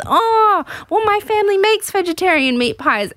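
high-pitched imitation of a keen suitor; mock-eager, lifted pitch; acted eager reassurance. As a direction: pipe up hopefully as the suitor. Retold suitor eagerly offering alternative.

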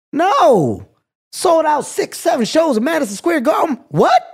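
high, loud disbelief; can you believe it?; incredulous. Real, not acted. Disbelief at his own worry.

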